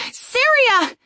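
high, loud call. A narrator's reading. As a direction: call her name in relief. Calling out to mage.

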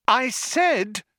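high, insistent yell. A narrator's reading. Trillian trying to be heard over singing, yelling. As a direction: cut in, voice pitched high. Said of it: "yelled Trillian".

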